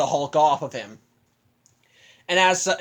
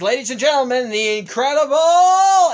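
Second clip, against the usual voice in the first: arena announcer; 7 semitones higher, 7 dB louder than usual. Retold or imitated. Arena host announcing the champion.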